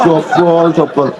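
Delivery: normal talking voice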